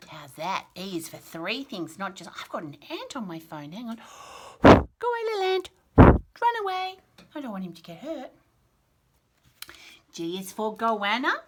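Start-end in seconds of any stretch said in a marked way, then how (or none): 4.6-7.0 much higher and louder, shooing something off; shooing an ant. Real, not acted. Shooing an ant off her phone, loud and high. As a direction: call it away, half laughing.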